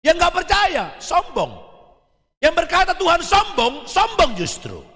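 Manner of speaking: high, forceful sermon climax; preaching at full force. Acted. Preacher urges youth to just believe, fervent preaching. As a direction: drive it home to the youth.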